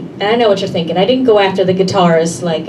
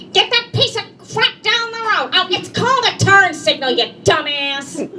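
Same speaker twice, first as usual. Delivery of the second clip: much higher mock road-rage yelling; high hammed-up road rage at drivers; mom's road rage; 8 semitones higher than usual. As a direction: rant at other drivers like a raging mom. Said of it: "a lot of shouting and a lot of swearing".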